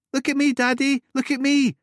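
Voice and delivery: put-on very high, loud child calling; excited little girl. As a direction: show off to Daddy. Lucy playing.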